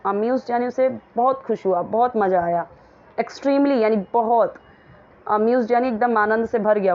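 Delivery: ordinary talk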